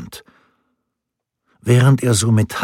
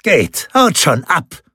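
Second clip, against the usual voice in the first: barked order; 7 semitones higher, slower than usual. A narrator's reading. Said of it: "herrschte Rinaldo sie an".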